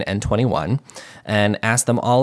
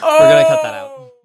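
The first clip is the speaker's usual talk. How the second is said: much higher, strained, half-laughing; high-pitched. Real, not acted. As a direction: squeak it out in alarm. High, strained producer remark.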